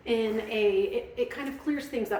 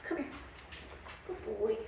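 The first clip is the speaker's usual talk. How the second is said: usual speaking voice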